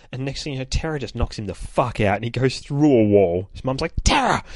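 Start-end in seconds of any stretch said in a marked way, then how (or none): 4.0-4.4 sharp, high mock-mum shout; scolding call. Mum reacting to daughter knocking a boy out, scolding call. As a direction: call out the child's name in reproach.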